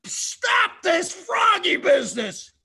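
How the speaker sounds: loud, rising, petulant king; fed-up Pharaoh. Acted. Pharaoh begging for the frogs to stop, loud. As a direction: demand it stop, whining loud. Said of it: "And Paro says".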